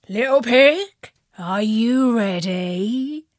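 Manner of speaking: slow, sly coaxing wolf. Acted. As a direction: tempt the pig slyly. Wolf calls the pig.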